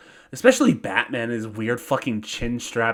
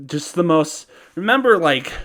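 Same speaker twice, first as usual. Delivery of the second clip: normal talking voice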